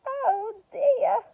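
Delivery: high, loud dramatic lament; dismayed oh dear. Acted. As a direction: wail it as a frustrated witch. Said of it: "said Winnie".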